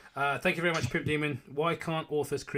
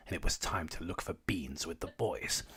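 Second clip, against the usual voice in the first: usual speaking voice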